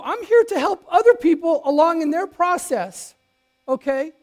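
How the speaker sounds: indignant inner voice. Retold or imitated. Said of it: "in my head, I go".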